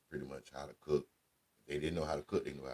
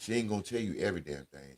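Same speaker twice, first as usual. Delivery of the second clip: ordinary talk, nothing marked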